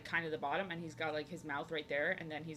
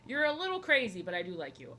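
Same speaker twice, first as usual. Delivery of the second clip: ordinary talk, nothing marked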